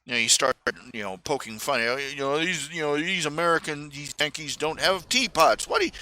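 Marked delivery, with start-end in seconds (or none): none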